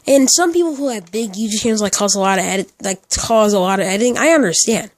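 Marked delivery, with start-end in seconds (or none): none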